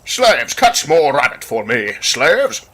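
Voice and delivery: high commanding royal call; pompous king barking orders. Acted. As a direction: order the servants about like a spoiled king. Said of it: "get into character for my king voice".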